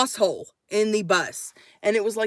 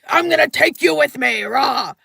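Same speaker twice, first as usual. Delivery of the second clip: mock aggressive kidnapper; 3 semitones higher, 4 dB louder than usual. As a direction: roar it like a cartoon abductor. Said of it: "he doesn't do it in a".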